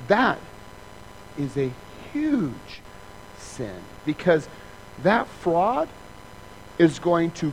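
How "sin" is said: normal talking voice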